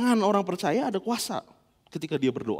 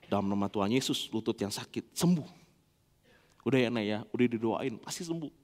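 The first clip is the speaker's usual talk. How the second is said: tender father prayer; 6 semitones lower, 3 dB quieter than usual. Retold or imitated. Father prays over hurt child.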